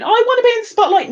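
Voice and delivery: high, fast, loud eager plea; eager hopeful. Retold or imitated. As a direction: mimic someone rushing up to beg. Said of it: "coming over to me and going".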